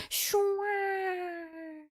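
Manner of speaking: high, soft, long "shwa" glide; smooth scooping sound. Shown as a demonstration. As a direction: imitate the scoop gliding through, softly fading. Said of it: "it goes like".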